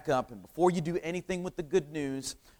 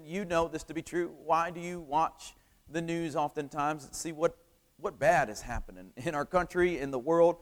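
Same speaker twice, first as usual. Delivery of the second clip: normal talking voice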